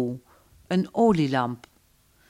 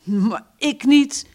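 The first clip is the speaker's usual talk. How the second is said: slow, higher and emphatic no; firm refusal; 8 semitones higher, 5 dB louder, slower than usual. Acted. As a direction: draw it out, decided. Anna rejects the Yankees.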